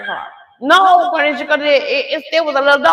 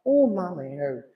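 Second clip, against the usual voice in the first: moaning; 5 semitones lower, 6 dB quieter than usual. Retold or imitated. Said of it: "she moaning".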